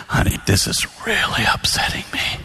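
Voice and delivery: breathy whispered confession to his wife. Retold or imitated. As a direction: hush it down to a whisper. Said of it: "say it really soft".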